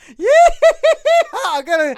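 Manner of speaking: loud, high Southern hillbilly shouting through laughs. Acted. Starfire boasts in a laughing Southern hillbilly holler. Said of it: "the hillbilly voice".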